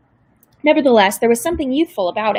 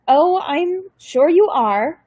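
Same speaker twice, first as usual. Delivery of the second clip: very slow, higher wry German-tinged drawl; dry sarcasm; 6 semitones higher, much slower than usual. A narrator's reading. Grandmother mocking the caseworker's claim, slow and dry. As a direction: let the doubt hang on every word.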